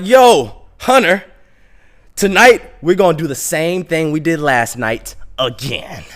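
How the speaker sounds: imitated friend rallying for a night out; hyped homies. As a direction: hail your buddy loudly. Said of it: "So my homies".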